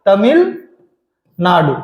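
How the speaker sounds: Tamil Nadu slowly, broken as Tamil, long pause, Nadu; drawn-out dictation. Shown as a demonstration. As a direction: split Tamil Nadu in two with a wide gap. Teacher spells out answer for class.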